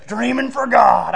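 loud, slow, stretched-out reveal; drawn-out mock proclamation; proud announcement. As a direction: announce it grandly, imitating a preacher friend. Retold proud announcement of sermon.

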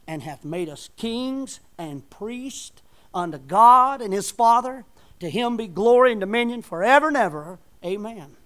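loud, slow scripture read from the pulpit; booming King James reading; scripture preaching. Acted. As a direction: proclaim the verse to the congregation.

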